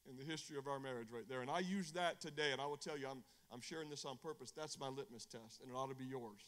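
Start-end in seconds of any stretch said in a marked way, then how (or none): none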